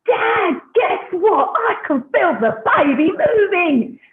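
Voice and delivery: high, drawn-out excited Cockney cry; thrilled Cockney accent, pitched high and slow. Retold or imitated. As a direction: blurt out the big news in broad Cockney. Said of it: "a Cockney accent".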